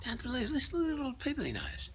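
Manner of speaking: mock disbelief from a tourist, up high; amazed American lady. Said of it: "this American lady".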